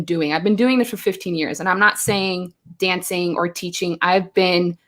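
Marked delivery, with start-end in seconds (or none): none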